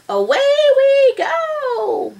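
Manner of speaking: high, loud, drawn-out holler; shouted cowboy cry. A narrator's reading. Cowboy setting off on Mercy, shouted. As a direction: stretch it into a long, high whoop. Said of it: "shouted Leroy and Anchor".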